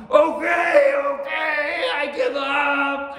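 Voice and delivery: high, desperate yell; crying out in surrender. A narrator's reading. Oni giving up to the samurai inside, wailing cry. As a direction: stretch it into a loud wail of defeat. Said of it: "he cried out".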